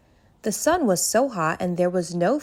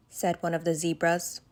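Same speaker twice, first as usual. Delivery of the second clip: ordinary delivery, nothing marked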